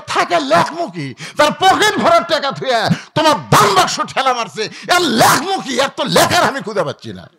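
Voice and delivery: high-pitched, loud protest of an overwhelmed scribe; exasperated complaint. Acted. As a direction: act out a flustered angel complaining. Angel complains to God in story. (উঁচু গলায় হতাশ নালিশ)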